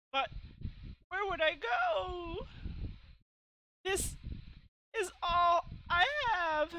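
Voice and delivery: high, drawn-out tearful wail; hammed-up old lady crying, slow and high; acted crying. Old lady losing her home to the collector, acted crying. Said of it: "I shouldn't be crying like this".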